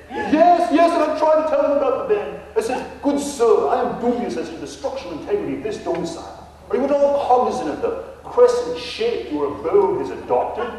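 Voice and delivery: hammed-up English gentleman ghost; mock English accent. Ghost Nigel answers the realtor in a fake English accent. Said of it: "a Texan trying to emulate an Englishman".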